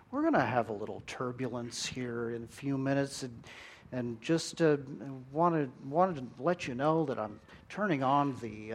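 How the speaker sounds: easy, soft southern pilot drawl over the intercom. Retold or imitated. Airline captain calms passengers, soft Southern drawl. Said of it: "that southern drawl".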